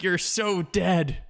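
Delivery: teasing slow-motion delivery; mock slow threat. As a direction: stretch out each word of the mock warning. Imitates slow taunt from the film.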